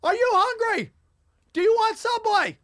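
high, loud, shouting an ordinary question; imitating a yell. Shanghai locals a foot apart, shouting, mock yell. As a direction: yell a friendly question like a quarrel. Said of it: "yelling in each other's faces".

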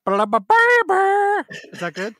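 high, very slow, drawn-out crooning mock [name]; [name] impression. Cohost asked for his [name], hammed-up high slow croon. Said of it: "Let's hear your [name]".

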